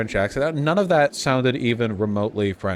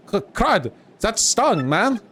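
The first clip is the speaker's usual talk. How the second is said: high-pitched cartoon villain lackey; stung henchman; 10 semitones higher, much slower than usual. Henchman just hit in battle, high pained complaint. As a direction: yelp it as a hurt, high-voiced minion. Voices a beaten game henchman.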